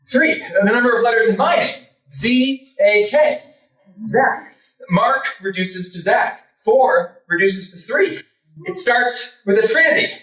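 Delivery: high, rising excitement of discovery; manic excitement. Retold or imitated. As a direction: build to a big revelation, pitched high. Reenacts manic numerological discovery.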